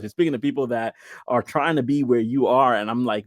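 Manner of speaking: ordinary delivery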